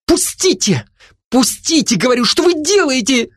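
high-pitched protesting squeal; shrieking. A narrator's reading. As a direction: cry out as a girl struggling to get free. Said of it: "переходя на визг, кричала девушка". (испуганный девичий визг)

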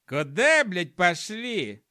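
high, loud gnome outrage that stretches out; indignant. A narrator's reading. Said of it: "возмутился гном".

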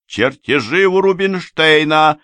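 high, loud announcement; shouted. A narrator's reading. Companion relaying the news to the false deacon, high shout. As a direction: shout the news across to someone. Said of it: "прокричала она".

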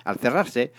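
ordinary delivery, nothing marked

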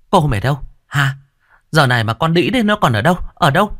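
high, tearful, angry demands; wailing shout. A narrator's reading. Bereaved mother demanding the maid find daughter-in-law, wailing shout. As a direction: cry out shrilly for the culprit. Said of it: "mụ gào khóc gọi con ở".

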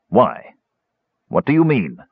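mildly harsh questioning. Acted. Caramon questioning the kender's serious tone, mildly harsh. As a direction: demand it with a touch of roughness. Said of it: "he asked harshly".

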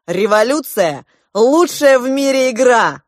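loud, high rallying cry; fired-up revolutionary prince; exultant proclamation. A narrator's reading. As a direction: proclaim it at full voice, rising high. Prince proclaims with flashing eyes.

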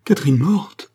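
horrified acted outcry; terrible cry. A narrator's reading. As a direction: cry out in horror, rising at the end. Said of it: "Il poussa un cri terrible".

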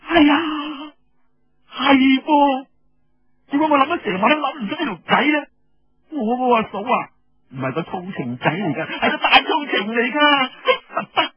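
very high, loud, excited; delighted girl. Acted. Xuanjiao delighted by Feng's plan, high and loud. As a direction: burst with glee at the idea.

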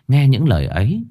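usual speaking voice

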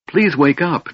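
high, pleading kender voice; begging. Acted. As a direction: plead with him to wake. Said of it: "Tass begged".

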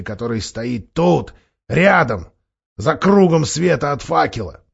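scheming dwarf. Acted. Dwarf urging the council, insistent. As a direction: press the council hard, dwarf. Dwarf Nikabrik urges allies.

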